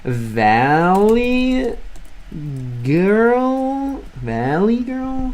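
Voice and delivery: put-on "valley" and "girl" drawn out, sliding high; sing-song valley girl demo of a rising tone; valley girl lilt. Shown as a demonstration. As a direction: stretch "valley girl" with long rising glides. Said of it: "this is more like valley".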